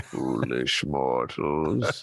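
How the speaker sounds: deep, slow haunted house host; low sinister welcome to the mansion; spooky ghost host. Retold or imitated. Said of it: "the voices that you just provided".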